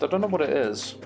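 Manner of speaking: usual speaking voice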